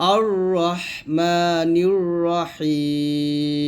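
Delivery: very slow chanted recitation; drawn-out tajweed recitation; verse begun afresh. Shown as a demonstration. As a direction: recite from a clear opening vowel, ending held long. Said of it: "আলিফের জবর দিয়ে পড়ব".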